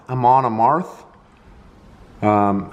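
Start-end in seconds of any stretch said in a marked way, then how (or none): 0.1-1.1 "[name]" as uh-MON uh-MARTH; questioning uh-MON uh-MARTH for "[name]"; trying a pronunciation. Shown as a demonstration.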